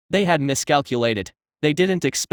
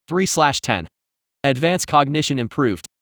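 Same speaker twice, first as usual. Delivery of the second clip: ordinary delivery, nothing marked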